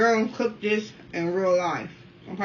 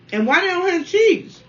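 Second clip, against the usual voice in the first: indignant question; 10 semitones higher, 7 dB louder than usual. Real, not acted. Complaining about missing sauce options.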